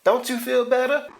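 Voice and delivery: high pitchman voice. Acted. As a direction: sell it in a squeaky high voice.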